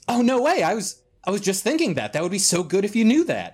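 high, delighted amazement, acted; feigned surprise. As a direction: jump up in pitch with pretend astonishment. Captain feigns surprise at shared thought.

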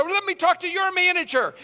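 re-enacted irate caller, high and loud; angry demand. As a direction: demand it like a fed-up customer. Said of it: "My tone of voice was angry".

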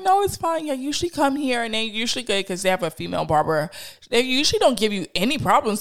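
high, quick, chatty reassuring stranger; polite lady voice. Retold or imitated. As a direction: brush off the apology, friendly and fast. Barbershop lady reassures the mom.

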